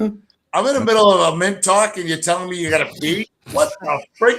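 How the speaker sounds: mock outrage. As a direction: bluster in disbelief at being interrupted. Interrupted for a pee, indignant.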